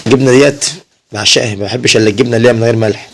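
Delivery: ordinary delivery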